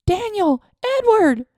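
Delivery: high, loud panicked scream of names. A narrator's reading. Aunt screams for the men, high and loud. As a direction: shriek for help across the yard. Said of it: "Flo screamed".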